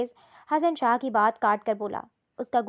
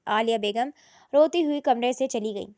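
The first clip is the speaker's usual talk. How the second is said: usual speaking voice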